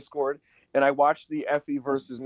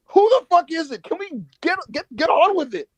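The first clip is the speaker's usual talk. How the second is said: high, raised-voice mock exasperation; exasperated impatience; 14 semitones higher, 4 dB louder than usual. Fan impatient for the real opponent, high exasperated outburst. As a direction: retell fed-up heckling, pitch up.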